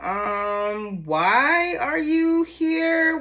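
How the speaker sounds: drawn-out, high-pitched, loud disbelief; mock-bewildered, voice climbing; incredulous. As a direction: stretch it out slowly and high, utterly baffled. Acts out listener's future incredulous reaction.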